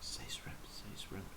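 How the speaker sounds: whispered chant, barely voiced; whispered plea. Real, not acted. Whispering to the streamer to pick shrimp. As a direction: whisper it like a secret wish.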